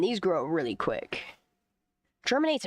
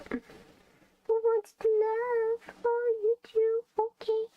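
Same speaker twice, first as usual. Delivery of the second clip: very high slow cooing; much slower than usual. Real, not acted. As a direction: stretch it out, cooing at the pet. Cooing to cat.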